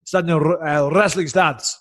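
put-on lightly done Russian accent, barking an order; a hint of a Russian coach's accent; Russian coach ordering the stance, mild Russian accent. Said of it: "heavy Russian accent".

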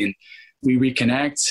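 ordinary delivery

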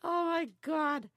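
high, slow, loud disbelief; shocked. Retold or imitated. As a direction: gasp it out in shock. Shocked at donkeys at window.

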